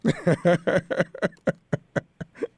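loose relieved laugh after the tension; host laughs as the prank ends; relieved laughter. Real, not acted.

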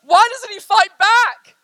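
high, loud, drawn-out cry; anguished cry. Acted. Sisters watching Aslan be bound, anguished cry. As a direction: cry it out in protest. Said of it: "they begin to cry to themselves".

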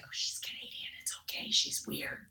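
hushed gossiping whisper; unvoiced gossip whisper. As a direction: whisper it behind her back. Retold whispering bystanders.

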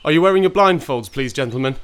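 calling out. Acted. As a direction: call to the men from the car. Calling out from the road.

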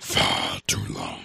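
very low, slow raspy whisper; dark lord voice. Pretend. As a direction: growl it like a prince of darkness. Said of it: "Now you sound like Orc".

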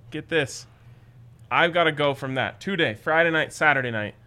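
normal talking voice

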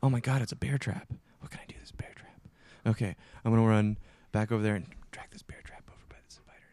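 hushed, fast, scheming whisper; startled whisper. Retold or imitated. Gamer whispering his plan to trap the spider. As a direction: plot it under your breath. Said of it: "you're like".

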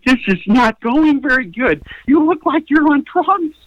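high, strained inner voice; high-pitched mock dismay; dismayed thought. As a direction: talk to yourself in rising alarm. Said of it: "and I think".

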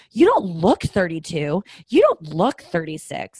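slow, loud, swooping mock disbelief; imitating stunned flatterers, key word stretched; mock amazement. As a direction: gush it like an overdone compliment. Mimics people's gushing age compliments.

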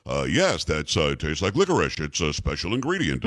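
low, dignified radio newsman impression; imitating a deep-voiced veteran anchor; deep announcer voice. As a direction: play it deep and straight-faced. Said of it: "Guthrie goes".

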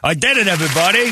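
loud, fast victory cry; triumphant shout. Acted. Leader announcing victory to everybody, triumphant yell. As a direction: yell it like you just won the title.

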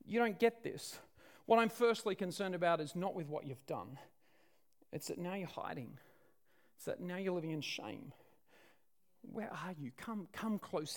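quiet, compassionate call to come near; gentle divine reassurance. Acted. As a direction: coax someone out of hiding. Voices God gently calling those hiding.

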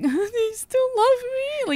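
very high, stretched-out whine; hammed-up insecure little voice; mock needy pleading. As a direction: squeak it slowly like a clingy bride. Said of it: "and I'll be like".

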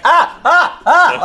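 high yelping ouch cries through a laugh; pained yelps. Retold or imitated. Retelling sunburn slaps, high yelping ouch cries. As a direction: yelp as each hearty slap lands on the sunburn. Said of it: "And I was like".

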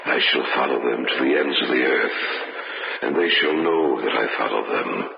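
deep ritual chant of doom, fading to a breath; sinister cult priest. Acted. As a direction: vow revenge like a chanting fanatic. Priest voice vows revenge.

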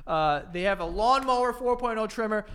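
loud ad read. Acted. Ad read, lawnmower pushed.

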